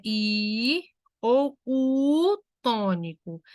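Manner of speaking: "I ou U tônico" slowly, I and U held long; vogais tônicas. Shown as a demonstration. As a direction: stretch the I and U in "I ou U tônico". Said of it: "Lembrando que tônico é forte". ("I ou U tônico" devagar, com I e U alongados)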